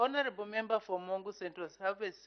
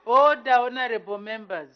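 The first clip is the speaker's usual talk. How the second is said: loud call to order; shouting order; 10 dB louder than usual. Real, not acted. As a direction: bark the House to order. Speaker calls House to order.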